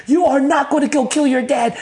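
loud, high, emphatic scolding; yelling. Retold or imitated. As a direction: act out a friend losing it at you. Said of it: "He's like, yelling at me".

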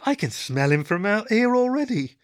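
high-pitched, repulsed complaint; grossed out, pitched right up; gagging disgust. A narrator's reading. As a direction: complain as if the stench is choking you. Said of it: "Blue gagged".

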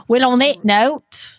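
put-on light New Zealand accent; Kiwi accent. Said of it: "I'm just gonna talk like this".

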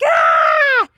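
very high, loud squeal of delight; delighted child scream. Retold or imitated. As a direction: squeal at the big present. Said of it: "And I'm like".

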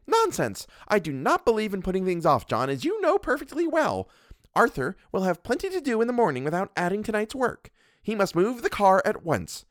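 a domineering wife scolding her husband; Mrs Montague. Acted. Mrs Montague lectures the doctor, haughty and bossy.

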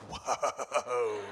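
long, drawn-out whoa with a laugh in it; mock-stunned whoa; retold astonishment. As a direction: imitate friends taken aback. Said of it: "they were like".

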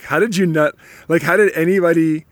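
ordinary delivery

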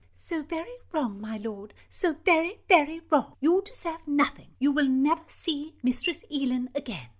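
high, loud cutting reproach; scornful princess. Acted. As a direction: lay into the knave with scorn. Princess condemns the marquis.

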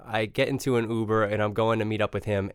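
ordinary talk, nothing marked

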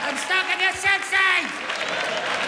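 very high, slow anguished cry; stuck in the sixties. Acted. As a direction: wail it, stuck in the year list. Panic at birth-year dropdown.

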